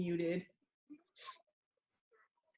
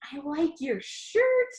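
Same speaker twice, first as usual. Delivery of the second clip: slow, higher disbelieving echo; incredulous self-mockery; 6 semitones higher, 5 dB louder than usual. Narrator echoes his awkward compliment, slow mocking disbelief. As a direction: repeat your own line as if you can't believe you said it. Narrator mocks own line.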